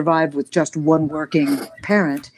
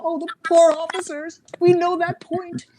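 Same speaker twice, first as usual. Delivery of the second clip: raised, mock-pitying kids' voice; kids' chorus; 10 semitones higher than usual. Siblings mocking the poor officers, light high kid voice. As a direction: pity the officers like a kid who's been there. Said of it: "all of us kids would go".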